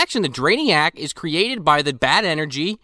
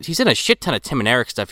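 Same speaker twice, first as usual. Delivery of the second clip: ordinary talk, nothing marked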